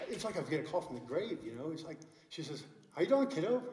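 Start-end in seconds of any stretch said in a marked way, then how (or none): 2.9-3.6 imitated upbeat phone hello, high and loud; retold cheery, loud hello; cheery greeting. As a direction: greet a relative brightly, pitched well up. Mother-in-law's phone greeting, acted.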